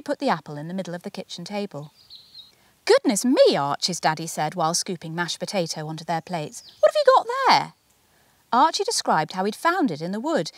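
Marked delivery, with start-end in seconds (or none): 2.8-3.9 high, louder exclamation of surprise; a dad amazed at the apple; surprised daddy. A narrator's reading. Daddy to Archie, surprised and high. 6.8-7.7 much higher, louder, wondering question; a dad peering at the apple; curious daddy. A narrator's reading. Daddy asking Archie, high and curious.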